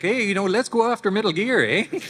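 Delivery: high-pitched Canadian accent. Shown as a demonstration. Voice actor mimicking his younger self for fans, Canadian accent. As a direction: put on a high, hammed-up Canadian lilt. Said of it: "I used to speak with a Canadian accent".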